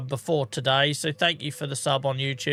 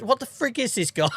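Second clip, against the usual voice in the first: stunned outburst; 8 semitones higher than usual. Real, not acted. Stunned by generous viewer gifts.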